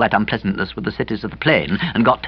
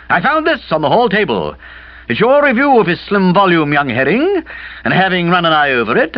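put-on higher, brisk older lady; brisk Aunt Dahlia; 7 semitones higher than usual. A narrator's reading. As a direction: lay out the evidence briskly.

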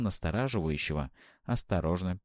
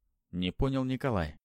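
ordinary delivery, nothing marked

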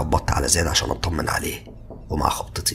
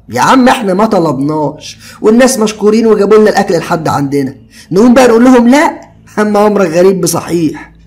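higher, louder easygoing friend; carefree young man, higher and louder; Ziad's casual voice; 11 dB louder than usual. A narrator's reading. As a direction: toss it off lightly like a carefree buddy. Ziad arguing.

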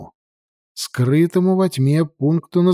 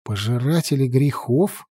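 plain reading voice

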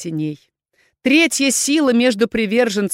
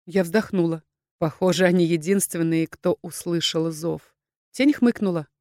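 usual speaking voice

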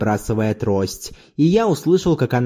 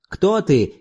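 understated cry, pitched up; mild shout; 7 semitones higher than usual. A narrator's reading. Zorbas challenging a stranger in the dark, mild shout. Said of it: "крикнул Зорбас".

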